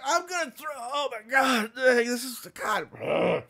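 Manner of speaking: high, strained gagging voice; queasy retching. Retold or imitated. Sick prisoner about to vomit, high queasy retching. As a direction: sound like you're about to throw up. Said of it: "and he's like".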